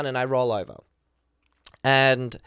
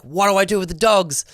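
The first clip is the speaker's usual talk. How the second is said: higher, louder frantic question; panicked; 6 semitones higher, 5 dB louder than usual. Retold or imitated. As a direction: blurt it out in a panic. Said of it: "I was just in full panic mode".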